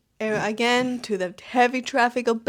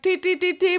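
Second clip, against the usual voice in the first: high-pitched repeated beeps; imitated car honks; 8 semitones higher, 3 dB louder than usual. Driver going inside through the crowd, honking imitation. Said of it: "Everyone honks in India".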